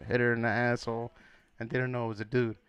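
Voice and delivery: ordinary talk, nothing marked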